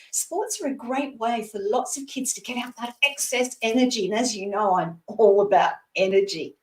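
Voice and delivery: ordinary delivery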